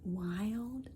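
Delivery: soft, fading to a whisper. A narrator's reading. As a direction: let it die away. Chant fading out.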